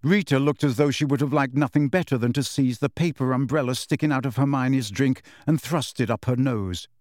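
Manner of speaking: plain reading voice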